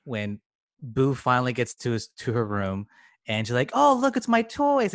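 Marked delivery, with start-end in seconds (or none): 3.7-4.9 put-on high, louder burst of childlike delight; excited little girl. Boo back in her room seeing her toys, excited. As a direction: imitate a thrilled child spotting toys. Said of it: "she gets really excited".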